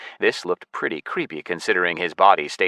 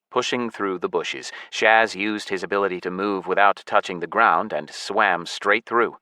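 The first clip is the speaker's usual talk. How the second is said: usual speaking voice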